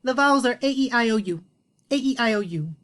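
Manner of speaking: high, loud, fast rattling off of the vowels; bright know-it-all rush; eager quick recital. Retold or imitated. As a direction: rattle them off like everyone knows them. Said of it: "they'll say".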